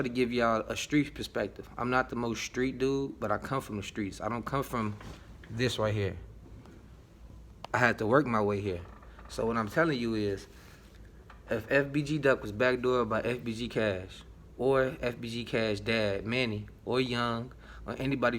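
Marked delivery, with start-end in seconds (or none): none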